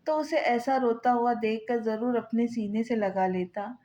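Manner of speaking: usual speaking voice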